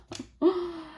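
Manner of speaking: short high laugh; a quick bright laugh. Real, not acted. Laughs at own joke.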